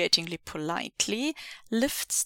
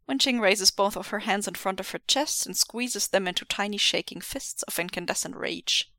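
usual speaking voice

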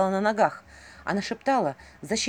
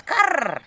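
raven caw; 9 semitones higher, 5 dB louder than usual. Acted. Raven caws in scene.

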